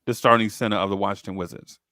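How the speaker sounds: usual speaking voice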